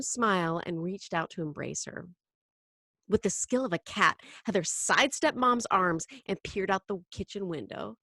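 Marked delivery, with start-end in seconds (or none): none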